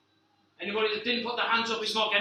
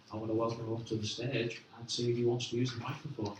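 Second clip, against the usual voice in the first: normal talking voice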